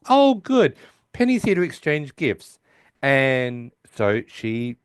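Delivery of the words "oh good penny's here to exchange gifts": high, loud eager Sheldon; a lightly done excited Sheldon; voicing Sheldon. Retelling the show, a high eager Sheldon. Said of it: "Sheldon comes from his bedroom and says".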